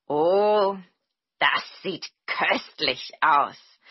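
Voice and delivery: long, loud "oh", then slow savouring words; delighted exclamation. Acted. Tokebi sees the dish his hosts made, delighted exclamation. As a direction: exclaim slowly with relish. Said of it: "rief er".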